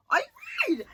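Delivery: very high, loud incredulous squeal; outraged disbelief. Real, not acted. Host reacting to a guest's insult in group chat, incredulous. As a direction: shoot way up in pitch, appalled.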